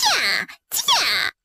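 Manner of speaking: high-pitched, self-satisfied giddy-ups; little fox crowing as it drives its mount; smug urging cry. A narrator's reading. Little fox riding the bear like a horse, smug high cries. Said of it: "得意洋洋地吆喝着".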